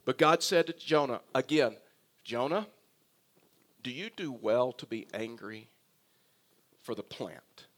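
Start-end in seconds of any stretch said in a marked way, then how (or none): 2.2-7.7 soft, low, gentle questioning; God's loving question. Acted. God gently questioning angry Jonah about the plant, tender and quiet. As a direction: ask tenderly, letting the voice sink lower and softer. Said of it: "Here's how loving God is".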